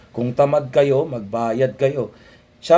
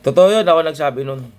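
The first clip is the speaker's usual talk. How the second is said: loud claim; 3 semitones higher, 9 dB louder, faster than usual. Real, not acted.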